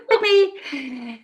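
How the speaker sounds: real laugh fading. Real, not acted. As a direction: settle down from laughter. Laughter continues.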